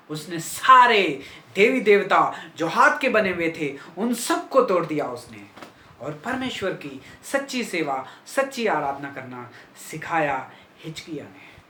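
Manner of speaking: normal talking voice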